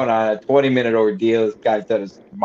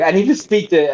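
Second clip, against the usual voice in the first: mock crying; 6 semitones higher than usual. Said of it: "was pretending to cry".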